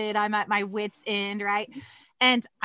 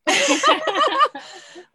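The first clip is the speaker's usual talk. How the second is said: bursting out laughing high; sudden high giggle; 14 semitones higher, 5 dB louder than usual. Real, not acted. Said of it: "laugh at own bratty voice".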